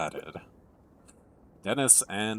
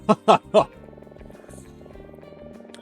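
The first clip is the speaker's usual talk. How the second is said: airy laugh; 8 semitones higher, 7 dB louder than usual. Acted. Character laughs lightly.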